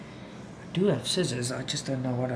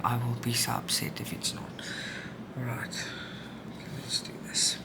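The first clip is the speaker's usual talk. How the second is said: soft, breathy worried whisper; mother whispering, worried the camera stopped; 2 semitones lower, 7 dB quieter than usual. Real, not acted. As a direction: whisper it to yourself, hoping it records. Worried camera not recording.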